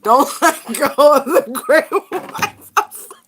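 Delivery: loud, high, laughing cry; laughing shout. Real, not acted. Excited laughing card reading.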